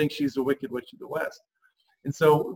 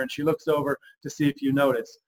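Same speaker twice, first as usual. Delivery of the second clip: usual speaking voice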